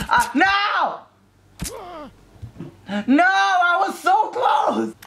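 slow, high furious wail; frustrated shout. Real, not acted. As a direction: yell out the near miss. Just failed a jump.

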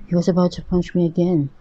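plain reading voice

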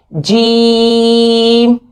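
जीम held very slow, voice kept out of the nose; jeem without nasal. Shown as a demonstration. Teacher models a clean जीम for students. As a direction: draw out जीम, nothing through the nose. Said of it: "आवाज़ नाक में ना जाने पाए".

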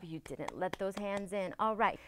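ordinary delivery, nothing marked

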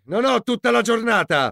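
ordinary delivery

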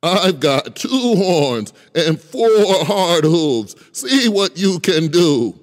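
loud big goat. Acted. Big goat challenges the troll.

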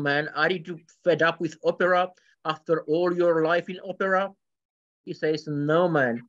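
normal talking voice

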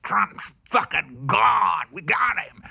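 high, loud, slow mock triumph; mocking gloat. Vlogger mocking the media's claims, loud hammed-up gloat. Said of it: "they've came out and said".

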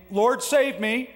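high, slow, loud desperate plea; drowning Peter crying for help; cried out. Acted. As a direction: cry for rescue while sinking. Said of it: "he cried out".

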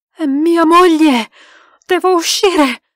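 loud, high, drawn-out; anguished cry. A narrator's reading. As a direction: cry out in dread for your wife. Husband hears wife's scream.

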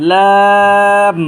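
লাম held very long, loud and level; drawn three counts. Shown as a demonstration. Teacher models a letter for learners, drawn very long. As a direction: stretch the vowel of লাম on one note. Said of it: "এবং তিন আলিফ টানবো".

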